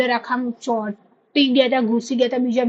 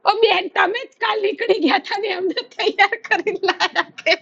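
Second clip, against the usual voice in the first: mock nurse voice; 10 semitones higher than usual. Nurse teasing her in retold scene.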